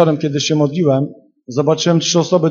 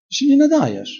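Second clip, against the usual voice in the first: mocking devil; 10 semitones higher than usual.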